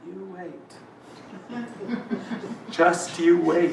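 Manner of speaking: hushed, slow and soft; quiet, patient promise under the breath; quietly. Acted. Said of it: "I say quietly".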